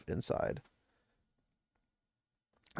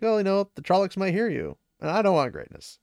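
mildly irritated imitation, pitched up; retold angry retort; 7 semitones higher than usual. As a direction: act out a cross comeback, higher than usual. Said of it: "Rand just is very angry".

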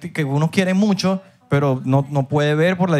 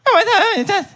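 much higher, thin voice without the effect; unprocessed mic voice; faster than usual. Shown as a demonstration. Host removes his mic effect to show the difference, higher thin voice. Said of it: "le voy a quitar el efecto al mío".

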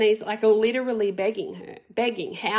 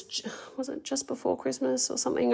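whisper; 3 semitones higher, 6 dB quieter than usual. Real, not acted. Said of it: "unvoiced whisper".

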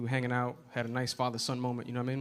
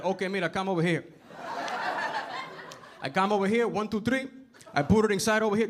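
put-on higher-pitched handyman dad in heavily accented English; dad's Spanish accent; 6 semitones higher than usual. Dad describing his work to the landlord, Spanish accent. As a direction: reel off numbers and punchy noises in a Spanish accent. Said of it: "And this is my dad".

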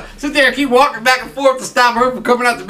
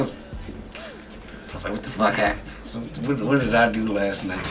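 low, subdued morning-after confusion; retold bewildered muttering; 13 semitones lower, 12 dB quieter than usual. Retelling the hungover morning after being drunk, bewildered low muttering. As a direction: act out quietly asking yourself, much lower.

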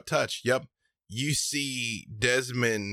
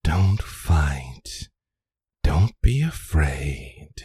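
low, slow, loud looming voice; plant voice in mind; 4 semitones lower, 6 dB louder, slower than usual. Acted. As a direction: speak as an ancient hungry plant, slow and heavy. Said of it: "you hear in your mind".

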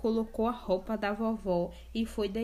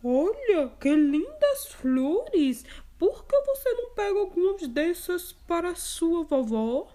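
sly wolf; 8 semitones higher, 5 dB louder than usual. Acted. Cunning wolf tempting the girl with flowers. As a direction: lure her off the path. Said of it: "O lobo, esperto, falou".

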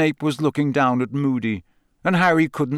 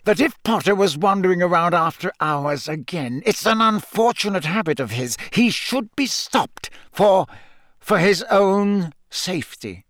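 higher, strained composure, slowing at the end; forced calm; 6 semitones higher than usual. A narrator's reading. Snape justifying his hunt for Potter, forced calm. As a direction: keep it polite under strain. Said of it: "in a voice of forced calm".

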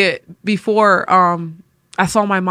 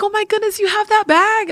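high, gushing mom voice, much higher; excited mom; 13 semitones higher than usual. Retold or imitated. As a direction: squeal it like an excited mother. Mom excited about the bag.

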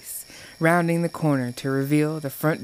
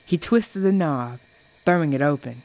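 plain reading voice

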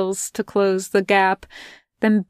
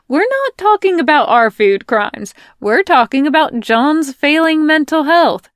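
higher, louder, taking charge; bossy Melanie; 7 semitones higher, 6 dB louder than usual. Acted. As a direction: steer them back firmly. Melanie steering back, raised.

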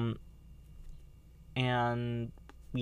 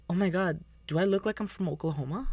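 mock diva; 6 semitones higher than usual. Acting out a show scene.

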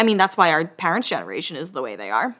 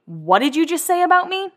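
higher, indignant comeback in a mock fight; mock angry Stacy; 9 semitones higher than usual. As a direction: play Stacy snapping back at Steph. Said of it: "And then Stacy's like".

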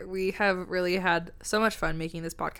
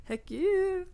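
high, bright heck yeah; echoing co-host in high excited voice; 12 semitones higher than usual. Real, not acted. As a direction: bounce it back, pitched up.

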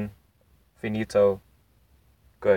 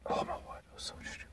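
whispered aside; whispering; 18 dB quieter, faster than usual. Real, not acted. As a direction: breathe it out in a whisper. Whispered aside about the show.

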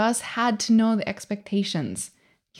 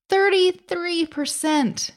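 thirty-three percent, higher and slow; emphatic repeat; 7 semitones higher, slower than usual. Real, not acted.

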